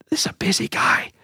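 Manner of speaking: breathy, conspiratorial whisper; whispered aside. Real, not acted. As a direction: lean in and whisper it like a secret.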